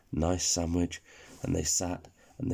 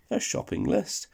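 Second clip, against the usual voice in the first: surprised question; 12 semitones higher than usual. Acted. Speedy Burger surprised at the list.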